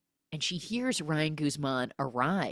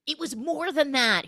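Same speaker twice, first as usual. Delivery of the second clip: high-pitched plea; mock impassioned insistence; 9 semitones higher, 4 dB louder than usual. Retold lover pleading it mattered.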